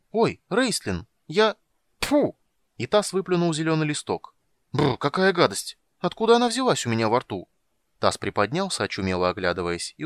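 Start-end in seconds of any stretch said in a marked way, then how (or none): 0.1-7.5 high, quick and chirpy; bright young kender, very high. Acted. Tas voiced high, excited.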